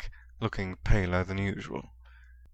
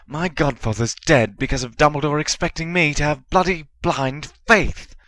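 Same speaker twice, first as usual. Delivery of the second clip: angry hiss; 7 semitones higher, 7 dB louder than usual. A narrator's reading. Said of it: "glared at Hermione's wide eyes and hissed".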